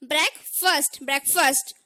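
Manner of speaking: ब्रेकफास्ट as short "brek-fust"; correct pronunciation. Shown as a demonstration. Teacher showing the short vowel, ब्रेकफास्ट as "brek-fust". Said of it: "वहां पे हमें stress नहीं देना है".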